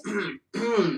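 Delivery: clears a dry throat; a rough, scratchy throat clear. Real, not acted. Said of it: "I got a frog in my throat".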